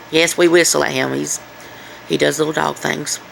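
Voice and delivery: ordinary delivery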